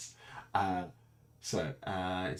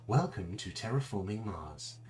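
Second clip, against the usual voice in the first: stilted computer voice with mechanical pitch jumps; creepy computer voice; 3 semitones higher than usual. Acted. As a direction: read it out like an eerie, robotic tutorial narrator. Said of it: "should have some creepy computer voice".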